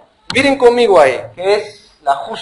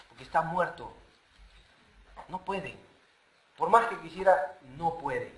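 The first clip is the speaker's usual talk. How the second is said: quiet; 4 semitones lower, 17 dB quieter than usual. Real, not acted. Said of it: "quiet sermon emphasis".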